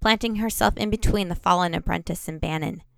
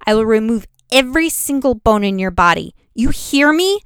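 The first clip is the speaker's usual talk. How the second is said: threatening; 4 semitones higher, 6 dB louder than usual. A narrator's reading. Threatening the bully.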